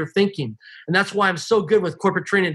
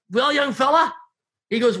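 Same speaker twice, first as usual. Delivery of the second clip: lightly voiced mentor calling to a pupil, higher; Harry; 7 semitones higher than usual. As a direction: imitate an older friend's folksy challenge, pitched up. Mentor pulls over, addresses him.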